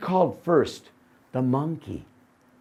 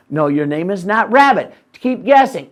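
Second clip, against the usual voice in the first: impatient shout; 5 semitones higher, 9 dB louder than usual. Acted. Man impatient, loud.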